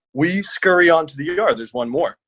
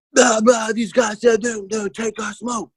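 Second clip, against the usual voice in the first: frantic retelling; 9 semitones higher than usual. Retold or imitated. Telling his buddy the guys want his smoke, in a high frantic half-shout. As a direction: blurt it out in a panic, nearly yelling.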